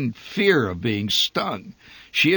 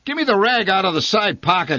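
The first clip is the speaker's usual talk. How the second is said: higher, louder barked command; yelled order; 10 semitones higher, 5 dB louder than usual. A narrator's reading. Father yelling for rag to chase bee, loud. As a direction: yell for the rag to fight the bee. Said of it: "he yelled".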